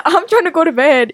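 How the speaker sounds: amused refusal, laugh in the voice; laughing protest. Real, not acted. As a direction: beg off through laughter. Laughing off scary stories suggestion.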